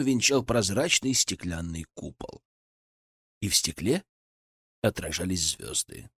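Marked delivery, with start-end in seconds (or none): none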